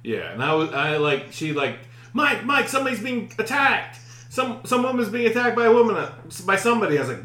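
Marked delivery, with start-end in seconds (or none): 2.1-7.0 high, urgent mock panic; panicked alarm. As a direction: imitate someone waking a partner in alarm. Acts partner waking speaker in alarm.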